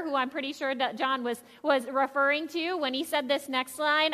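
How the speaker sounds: ordinary delivery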